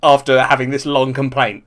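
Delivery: usual speaking voice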